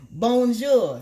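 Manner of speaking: Bonjour called out high and loud, nasal French o; French greeting. Shown as a demonstration. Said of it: "That means hello".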